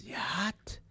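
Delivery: high, disbelieving one-word question; incredulous coach. Retold or imitated. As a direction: react like he heard something absurd. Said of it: "He says".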